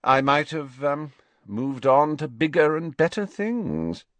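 high, loud, self-important hint; hint grandly, pitched well up; smug hinting. A narrator's reading. Malfoy hints self-importantly at plans.

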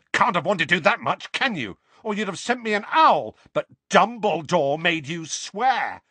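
high, loud, bitter retort; angry accusation. A narrator's reading. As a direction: throw the accusation back at them. Harry cuts in, still raging.

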